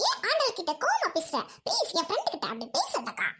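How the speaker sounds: swooping put-on voice, leaping high; wildly up-and-down protest; excitable friend. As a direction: plead in exaggerated sing-song. Excitable friend defends Andal.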